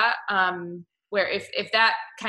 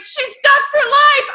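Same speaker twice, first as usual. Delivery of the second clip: much higher, louder, slow despairing cry; dismayed wail; 15 dB louder, slower than usual. Retold or imitated. Lamenting the wife's fate, high slow loud wail. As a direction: wail it like it's a tragedy. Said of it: "So I was like".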